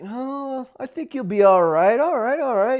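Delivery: playful retold reassurance; mock confident. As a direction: toss it off like it's nothing. Joking bravado quote.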